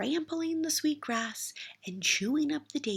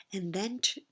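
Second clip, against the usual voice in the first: ordinary delivery, nothing marked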